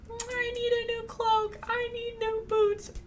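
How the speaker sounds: very high, squeaky put-on voice; exaggerated falsetto demand; mock whiny sisters. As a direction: mimic spoiled sisters in a tiny high pitch. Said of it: "they're immediately like".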